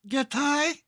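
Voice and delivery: put-on very high squeaky anime girl cry; Japanese girl voice. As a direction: squeal it like a cartoon schoolgirl. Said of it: "Japanese girl voice".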